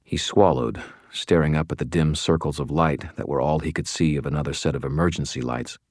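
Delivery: usual speaking voice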